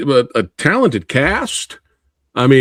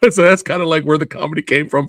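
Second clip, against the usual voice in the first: ordinary delivery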